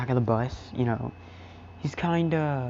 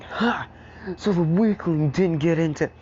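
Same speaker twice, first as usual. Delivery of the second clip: mocking taunt; 5 semitones higher, 5 dB louder than usual. Bakugo taunting Midoriya.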